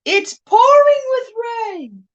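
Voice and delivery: exclaiming. Real, not acted. Exclaiming about the weather outside.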